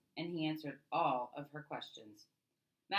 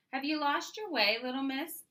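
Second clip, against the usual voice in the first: put-on higher, louder friendly tease to a child; kindly machinist; 9 semitones higher, 6 dB louder than usual. A narrator's reading. Machinist greeting the girl in his shop, higher friendly tease. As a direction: gently tease the little girl. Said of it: "a man replied".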